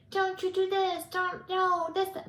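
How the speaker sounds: high, loud mocking of a scolding grandma; granddaughter mocking grandma. Granddaughter mimics Oma's scolding, high and loud mock. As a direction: squeal the scolding back at Oma. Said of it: "Later, she was going".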